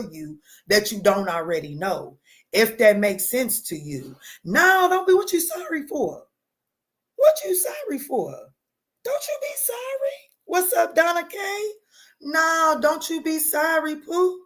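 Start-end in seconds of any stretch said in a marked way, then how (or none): 4.4-8.3 much higher, cooing reassurance; high sing-song comfort; sweet reassurance. Real, not acted. Reassuring an apologizing viewer. 9.0-10.2 very high, breathy whispered comfort; whispered high reassurance; tender whisper. Real, not acted. Reassuring an apologizing viewer. 10.4-14.4 much higher, cooing reassurance; high sing-song comfort; sweet reassurance. Real, not acted. Greeting and reassuring viewer.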